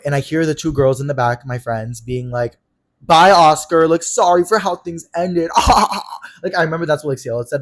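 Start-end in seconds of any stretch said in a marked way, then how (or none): 3.0-5.5 loud, high mock farewell with drawn-out words; joking send-off. Friends joking behind his back as Oscar leaves, mocking send-off. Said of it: "making a joke out of it". 5.6-6.4 loud, high mock laugh; imitated mocking laugh. Friends laughing in the back after the goodbye, mocking laugh. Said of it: "making a joke out of it".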